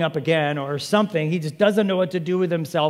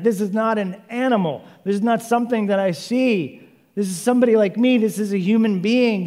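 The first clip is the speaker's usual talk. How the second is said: raised, loud, amazed exclamation; thrilled outburst of discovery; excited; 6 semitones higher, 6 dB louder than usual. Acted. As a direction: marvel out loud, building in volume. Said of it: "Man is so excited".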